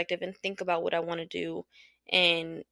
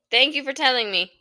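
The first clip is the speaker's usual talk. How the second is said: sharp retort repeated; 5 semitones higher, 7 dB louder than usual. Real, not acted. Arguing with partner off mic.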